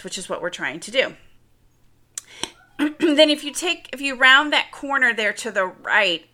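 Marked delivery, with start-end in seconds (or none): 2.8-6.3 clearing throat mid-sentence; choking. Real, not acted. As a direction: talk through a catch in the throat. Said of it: "sorry, I was choking there".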